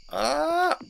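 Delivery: sudden high yelp of fright; startled scream. Real, not acted. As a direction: shriek as danger hits. Nearly dies in the game.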